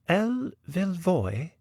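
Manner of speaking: high, slow, drawn-out repetition; puzzled lady novelist. Acted. Novelist repeats the unfamiliar name, high puzzled voice. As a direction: echo the name slowly in a high lady's voice.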